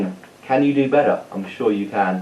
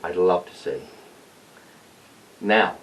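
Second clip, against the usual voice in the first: ordinary delivery, nothing marked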